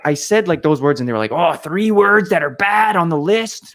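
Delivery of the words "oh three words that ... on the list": high, loud, slow mock horror; mock outrage. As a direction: mimic scandalized classmates, drawn out and high. Mocking offended classmates' reaction.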